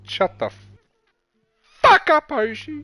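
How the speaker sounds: high, loud outburst, paused midway; mock angry shout. Telling the teasing friend off, in a mock angry shout. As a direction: build to a loud high yell on "fuck up".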